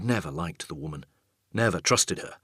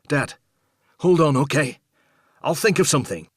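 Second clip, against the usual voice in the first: higher, louder call to hold on; urgent reassurance; 7 semitones higher, 7 dB louder than usual. Acted. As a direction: urge him to hang on, raised voice. Strapped son reassures dad.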